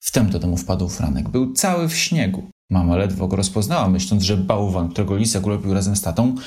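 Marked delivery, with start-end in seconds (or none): none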